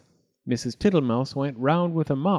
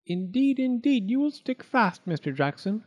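higher, prim mouse voice; higher prim little mouse; fussy little mouse; 10 semitones higher than usual. Acted. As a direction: politely refuse the greedy guest. Mouse warns the toad.

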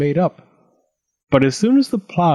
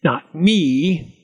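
lazy goblin; 4 semitones higher than usual. A narrator's reading. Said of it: "yawned and leaned lazily".

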